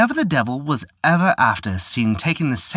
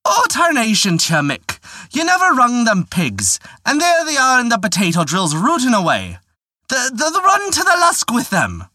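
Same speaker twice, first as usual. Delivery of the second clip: yelling wife; 12 semitones higher than usual. Acted. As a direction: shout at a lazy husband, pitched high. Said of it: "crying out to her husband".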